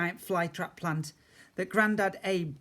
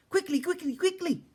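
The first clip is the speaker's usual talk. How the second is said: squeaky creature; 4 dB louder than usual. A narrator's reading. Dust pook keeps calling out.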